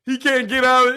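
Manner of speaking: ordinary talk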